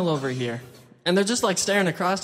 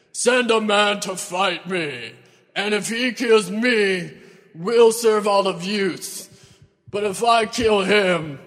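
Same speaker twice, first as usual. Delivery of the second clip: slow, booming, louder giant's challenge; Goliath shouting; 6 dB louder, slower than usual. Acted. Giant taunting the Israelite army, slow booming shout. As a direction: roar it slowly across the valley like a giant. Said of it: "then he shouts out".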